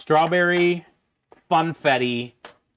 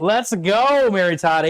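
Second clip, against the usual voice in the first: higher, louder hype; excited cheer; 6 semitones higher, 4 dB louder, slower than usual. Real, not acted. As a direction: cheer the viewer on. Cheering thanks for a gift.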